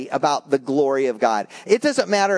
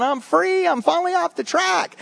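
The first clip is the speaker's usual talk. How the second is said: mock cartoon yell; 12 semitones higher than usual.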